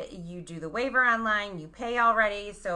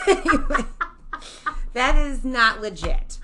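laughing; 5 semitones higher, 7 dB louder than usual. Real, not acted. Laughing at turban joke.

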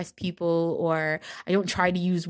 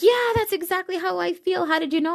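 much higher, amazed client voice; 11 semitones higher than usual. Retold or imitated. Client amazed by her reading, high and gushing. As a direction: play the stunned client gushing. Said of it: "they'll be like".

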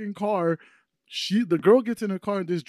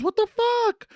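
high-pitched mock female outrage; imitating shocked girl; slower than usual. Girl seeing her car rocking, high shocked mock squeal. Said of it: "'Cause she goes".

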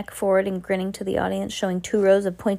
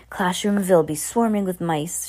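usual speaking voice

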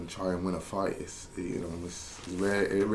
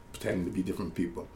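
normal talking voice

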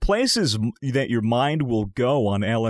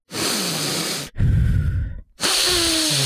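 heavy breathing; 13 semitones higher than usual. Retold or imitated. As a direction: breathe out long and noisily, mimicking the roommate. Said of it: "and, and breathing".

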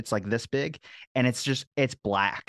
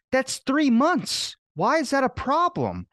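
high, slow, loud disbelief; retelling a stunned reply to the pharmacist; incredulous; 13 semitones higher, 4 dB louder, slower than usual. Retold or imitated. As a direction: stretch it out in outraged disbelief.

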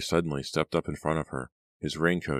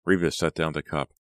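ordinary delivery, nothing marked

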